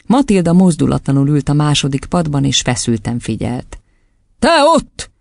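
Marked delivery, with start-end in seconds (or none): 4.4-5.1 high, sudden cry; single someone out with a shout. A narrator's reading. Headmistress picking out a pupil, shouting suddenly. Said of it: "kiáltott fel hirtelen Doron kisasszony".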